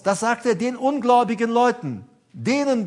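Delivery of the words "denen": normal talking voice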